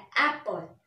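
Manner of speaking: Apple with its first vowel stressed; stress on first syllable. Shown as a demonstration. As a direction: put the effort on the start of Apple. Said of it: "ए पर बहुत ज़्यादा stress".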